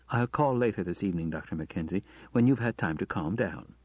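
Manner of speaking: usual speaking voice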